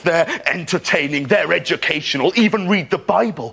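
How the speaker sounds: earnest lecturing dad, rising to high slow rapture; old-fashioned dad. Acted. Dad urging his son to read, earnest preachy build. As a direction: start measured, build into drawn-out high fervour. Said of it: "Like my dad, he's quite old-fashioned. He's always saying".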